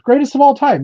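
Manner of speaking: high, loud hype; excited praise. Real, not acted. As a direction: cheer him on. Praising Dave loudly.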